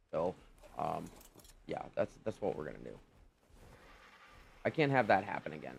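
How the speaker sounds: usual speaking voice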